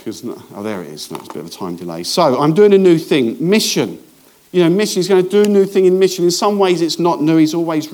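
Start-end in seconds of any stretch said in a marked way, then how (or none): 2.0-7.9 louder, emphatic preaching push; preacher hammering on new thing and mission, emphatic; forceful preaching. Acted. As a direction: drive the point home to the church. Preacher presses the point.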